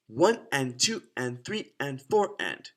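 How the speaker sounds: slow, even counting on the beat; deliberate, measured rhythm count. Shown as a demonstration. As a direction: count the eighth notes out slowly and evenly. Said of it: "Let me slow it down a little bit".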